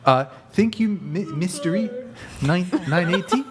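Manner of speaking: hammed-up Kiwi thank-you; Kiwi accent. Shown as a demonstration. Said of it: "can anybody do a Kiwi accent?".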